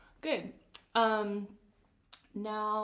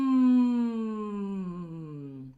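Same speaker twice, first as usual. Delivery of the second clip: hummed glissando; 8 dB louder than usual. Shown as a demonstration. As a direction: glide down the range on a hum. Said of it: "same thing on a mm".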